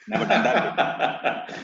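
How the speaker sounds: amused chuckle through words; laughing. Real, not acted. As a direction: laugh it out at the coincidence. Amused by the double name.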